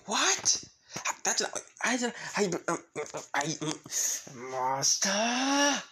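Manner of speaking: high, sputtering outrage; stammer, then wail the name in protest; tricked and flustered. Acted. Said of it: "being tricked".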